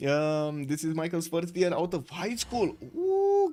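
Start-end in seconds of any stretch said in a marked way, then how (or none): 2.9-3.5 teasing ooh. Real, not acted. Reacting to player growing up.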